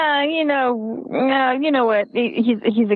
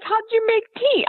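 incredulous mom; 11 semitones higher than usual. Retold or imitated. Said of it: "And I'm like".

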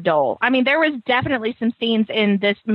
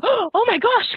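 imitated amazement; 9 semitones higher, slower than usual. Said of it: "gasping and going".

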